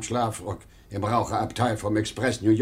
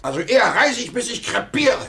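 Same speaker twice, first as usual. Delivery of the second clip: rising, louder protest; defiant outburst; 10 semitones higher, 5 dB louder than usual. Acted. Salesman defying his boss, raised angry voice. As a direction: throw it back at the boss in defiance.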